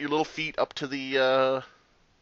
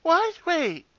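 very high squeaky voice; mock bewilderment; slower than usual. Reading out the victim's confused chat, mocking high squeak. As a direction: squeak it like a panicked, clueless player. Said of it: "Pity says".

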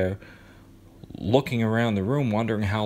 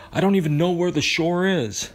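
high, loud panic; desperate cry in the dark water; panicked; 9 semitones higher, 5 dB louder than usual. A narrator's reading. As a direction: Charlie panicking at sea.

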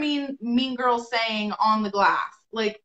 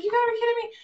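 high-pitched, incredulous complaint; retold disbelief; 11 semitones higher, much faster than usual. Telling Eric about the missing order, incredulous. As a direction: let the pitch climb in exasperation.

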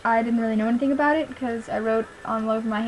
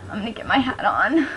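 whispered aside; 4 semitones lower than usual. Real, not acted. Aside while fixing her hair.

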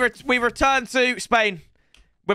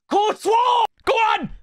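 cheering shout; 11 semitones higher, slower than usual. Real, not acted. Cheering his keeper's save.

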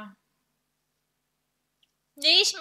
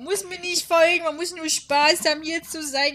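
emphatic insistence; 4 semitones higher, 7 dB louder than usual. Real, not acted. Insists nobody has to follow.

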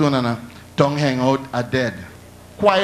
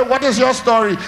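raised voice; 6 semitones higher than usual. Retold or imitated. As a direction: shout the question at him. Demanding again, louder.

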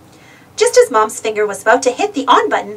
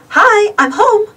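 high, lilting call; 10 semitones higher, 4 dB louder, slower than usual. A narrator's reading. Mom greets the family on coming home, calling out. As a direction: announce an arrival across the house. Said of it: "called Mom".

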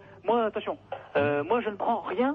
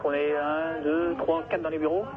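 tinny telephone sound; over the phone; 2 semitones lower than usual. Real, not acted. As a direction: voice through a phone line. Callee ordering by phone. (au téléphone)

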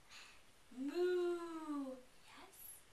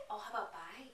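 ordinary talk

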